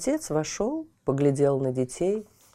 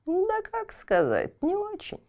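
put-on sad old woman.

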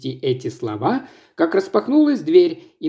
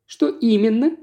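as coldly as possible; 6 semitones higher than usual. Acted. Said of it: "как можно более холодно".

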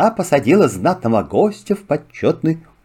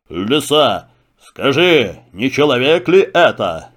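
lion voice. Acted. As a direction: speak as a calm king of beasts. Lion speaks. (голос льва)

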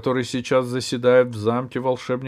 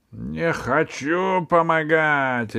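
roaring; 6 semitones higher, 3 dB louder, much slower than usual. Acted. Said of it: "ревел Джин".

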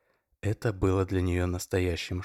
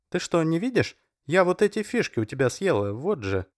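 much higher, puzzled explaining; Masha's voice; 9 semitones higher, 4 dB louder than usual. A narrator's reading. Masha, confused, shows Oleg the stones she took, much higher. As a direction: protest innocently.

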